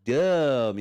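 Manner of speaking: slow, drawn-out Southern mock yell; hammed-up Southern holler; imitated Southern accent. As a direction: imitate a Southern neighbor hollering, stretching each word. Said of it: "she said exactly like this".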